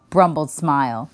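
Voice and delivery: ordinary delivery, nothing marked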